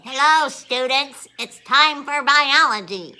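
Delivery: aged teacher greeting the class, hammed up; old teacher with walker. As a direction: play an ancient teacher shuffling in. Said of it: "walking in with my walker, going".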